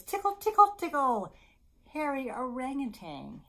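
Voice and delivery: high, loud tickle chant easing off; playful read-aloud. Acted. Storyteller reading an animal book to babies, playful.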